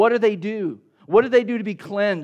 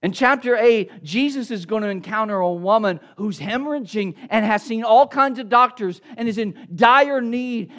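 ordinary talk, nothing marked

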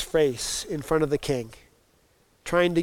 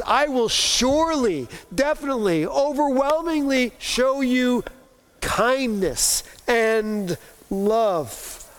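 higher, building stress on surely, definitely, overwhelmingly; emphatic promise; 7 semitones higher than usual. Acted. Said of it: "it's in the emphatic tense".

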